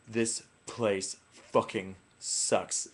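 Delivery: very slow, deliberate delivery; slow provocation. Retold or imitated. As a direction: space out each word as a dare. Retold deliberate provocation after dare.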